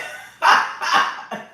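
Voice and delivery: loud, open cackle; real laugh. Real, not acted. Teasing someone about a shirt about to bust, loud laugh. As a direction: burst out laughing at your own teasing.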